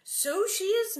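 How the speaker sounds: high smug satisfaction. Acted. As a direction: say it with a self-satisfied smile. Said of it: "Mary smiled in satisfaction".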